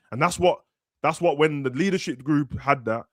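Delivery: ordinary talk, nothing marked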